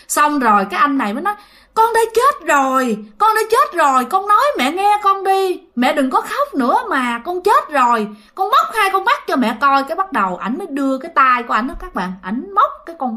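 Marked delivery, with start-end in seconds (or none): 1.7-9.8 high-pitched retold pleading from the son; urgent insistence. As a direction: act a son begging to be believed.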